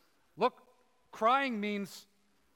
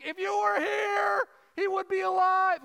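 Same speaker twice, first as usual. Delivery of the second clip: wailing cry; 10 semitones higher, 8 dB louder than usual. Acted. Grieving Mary crying out to Jesus, wailing. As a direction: cry it out to him in raw grief. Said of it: "And she's wailing".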